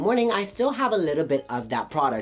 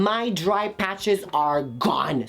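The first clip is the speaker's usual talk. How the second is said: slow triumph; much slower than usual. Acted. Slowed triumphant claim.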